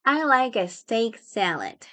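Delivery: slow, evenly spaced words; unhurried teaching pronunciation; slow model. Shown as a demonstration. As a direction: repeat it slowly for learners. Teacher models phrase slowly.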